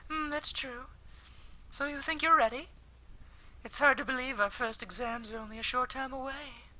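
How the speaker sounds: bright high-pitched schoolgirl voice; high girl voice. Acted. Voicing female character Akiko.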